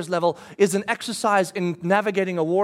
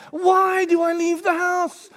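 slow, higher, despairing; exasperated thought; 9 semitones higher, slower than usual.

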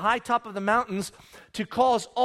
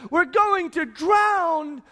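panicked plea; 7 semitones higher, much slower than usual. Acted. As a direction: beg the master in terror.

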